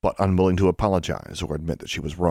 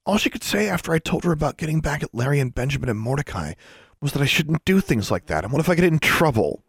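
climbing higher, mocking the friend's worried tone; rising imitation; 9 semitones higher than usual. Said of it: "Her voice was rising as she imitated Anna's tone".

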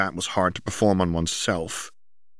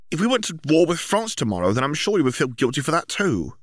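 put-on higher girl's voice, dry teasing; mock her brother as Ginny, pitched up; Ginny voiced higher, mocking; 9 semitones higher than usual. A narrator's reading. Ginny voiced in a read story.

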